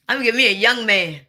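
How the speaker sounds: usual speaking voice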